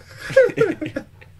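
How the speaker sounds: high burst of laughter; squealing laugh. Real, not acted. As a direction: laugh up high at his own joke. Gloating after his prediction.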